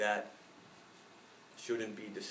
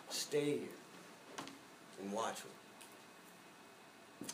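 soft, slow quote of a request, fading away; hushed plea; 10 dB quieter, much slower than usual. Retold or imitated. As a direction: let the words trail into near silence. Quoting Christ's request to his friends.

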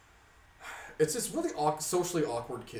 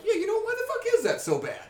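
much higher, exasperated mock question; incredulous outburst; 6 dB louder than usual. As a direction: fling it out as pure disbelief. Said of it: "I'm like".

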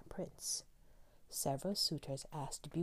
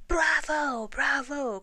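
much higher, much louder cheer; 11 semitones higher, 10 dB louder, slower than usual. Acted. Mice cheering the young mouse's plan, loud bravo. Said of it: "cried the mice".

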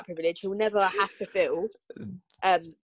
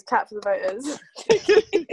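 sarcastic slow clap line; 2 semitones higher, 4 dB louder, much slower than usual. Acted. Sarcastic repeat, slow, laughing.

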